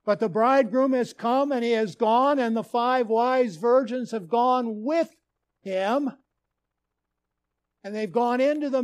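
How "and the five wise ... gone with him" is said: usual speaking voice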